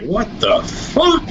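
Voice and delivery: slow, high, breathless what the fuck; a stunned, disbelieving gasp of a question; stunned disbelief. Retold or imitated. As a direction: drag it out in shock. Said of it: "it's just blown my wig off".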